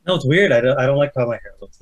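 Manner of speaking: normal talking voice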